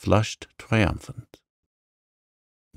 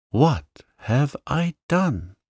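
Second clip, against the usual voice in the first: second moan pitched higher, slower; rising moan; 7 semitones higher, slower than usual. A narrator's reading. Janet repeats her regret, higher and slower despairing moan. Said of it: "moaned poor Janet".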